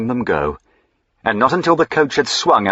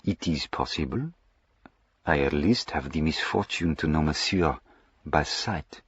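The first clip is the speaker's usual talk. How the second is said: put-on a touch more French accent; stronger French accent; 3 semitones lower, 8 dB quieter than usual. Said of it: "French accent became a shade more pronounced".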